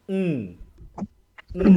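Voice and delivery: উম as a held nasal ng hum; ng sound demo. Shown as a demonstration. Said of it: "এন আর জি মিলে উচ্চারণ হচ্ছে কি".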